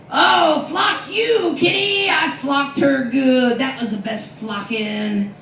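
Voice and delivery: high, loud drunken mock yell, slowing at the end; drunk manager yelling. Comic mimics her drunk manager, high and loud. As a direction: ham up a drunk boss slurring out taunts.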